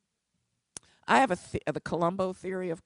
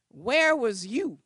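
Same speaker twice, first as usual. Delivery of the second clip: accusing question; 5 semitones higher, 9 dB louder, slower than usual. Retold or imitated. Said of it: "She reamed me a new one".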